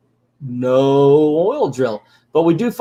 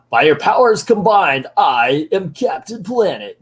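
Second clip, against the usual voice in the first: higher, louder superhero proclamation; Captain Planet; 4 semitones higher, 3 dB louder than usual. Retold or imitated. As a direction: announce yourself like a cartoon superhero.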